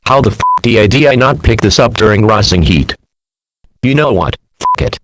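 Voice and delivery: loud, exasperated outburst at the screen; furious rant. Real, not acted. Reacting to the Sun moving, a loud angry rant. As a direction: blow up at the absurd premise. Ranting at an impossible scenario.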